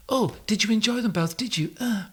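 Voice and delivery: high, soft teasing wife voice. Acted. Wife voiced in couple sketch.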